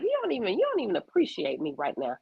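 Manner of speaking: high, indignant repeat of the complaint; mock exasperation. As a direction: play the unappreciated mom for laughs. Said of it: "I'm like".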